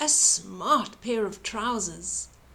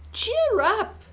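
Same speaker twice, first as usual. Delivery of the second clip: high bright encouragement; cheery comfort; 11 semitones higher, 4 dB louder than usual. A narrator's reading. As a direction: lift them up with a high cheer. Giant comforting the goat.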